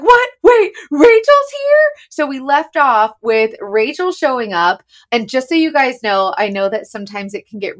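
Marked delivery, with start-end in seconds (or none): 0.0-1.9 much higher and louder, drawn out; shocked surprise. Retold or imitated. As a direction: act stunned someone just showed up. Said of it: "Me and Hannah are like".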